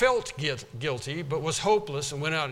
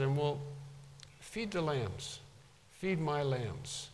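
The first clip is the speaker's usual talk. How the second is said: gentle commission; 7 dB quieter, slower than usual. Retold or imitated. Lord gently commissioning Peter.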